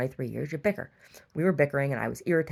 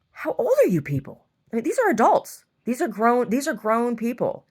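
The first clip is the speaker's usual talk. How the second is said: exasperated disbelief; 7 semitones higher, 5 dB louder than usual. Retold or imitated. Outraged at adults leaving garbage.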